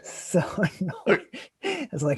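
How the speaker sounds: ordinary talk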